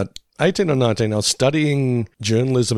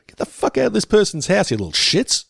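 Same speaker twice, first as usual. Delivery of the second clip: raised, higher angry outburst; mock outrage; 9 semitones higher than usual. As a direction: bark them out of the house. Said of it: "but I was like".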